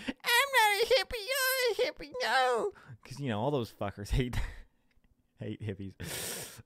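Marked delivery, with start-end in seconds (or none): 0.1-2.7 mock hippie comeback. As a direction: squeal a feeble comeback as the hippie. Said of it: "what are they gonna come back with".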